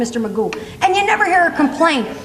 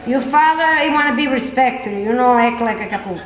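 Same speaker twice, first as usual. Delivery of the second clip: put-on mother's Italian accent; 4 semitones lower than usual. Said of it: "My mother gave us a little warning".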